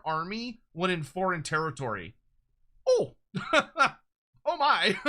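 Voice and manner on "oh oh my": laughing. Real, not acted. Laughing at huge melee buff.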